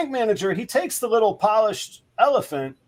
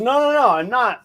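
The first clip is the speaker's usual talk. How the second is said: raised, louder string of denials; quick protest; 5 semitones higher, 5 dB louder than usual. Acted. Snake denying to the bank teller he's the wrestler, protesting. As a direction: protest a mistaken identity, pitched up.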